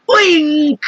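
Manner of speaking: high mock scream. A narrator's reading. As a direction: shriek the name. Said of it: "screamed the head of the fire department".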